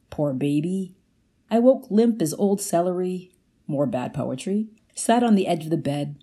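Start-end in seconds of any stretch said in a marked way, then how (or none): none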